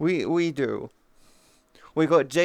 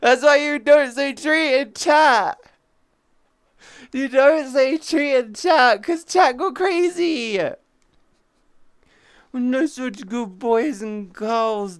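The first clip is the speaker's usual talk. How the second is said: high, slow, fake sobbing; mock crying; 7 semitones higher, 6 dB louder, slower than usual. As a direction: whine it like a hammed-up cry. Wailing mock crying about chat.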